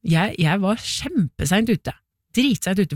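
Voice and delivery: usual speaking voice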